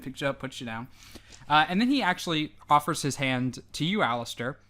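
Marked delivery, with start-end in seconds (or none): none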